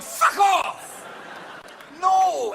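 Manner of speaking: angry Scottish retort. Acted. Scots objecting to the kilt rule, shouted. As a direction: bark it out offended. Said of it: "the Scottish are going".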